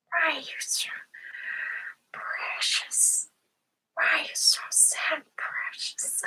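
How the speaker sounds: high whispery creature voice; hushed, high-pitched fantasy creature; Gollum. Acted. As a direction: whisper it softly like a wretched creature. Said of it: "Oh, Gollum. ... get into character".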